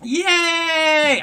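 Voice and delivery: high, loud cheer; excited cheer. Real, not acted. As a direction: cheer her on. Cheering for the caller.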